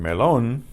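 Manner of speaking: melon said me-LON; weight on the final syllable of melon; Spanish melon, accented on the O; stress on O. Shown as a demonstration. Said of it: "normally wouldn't be pronounced that way".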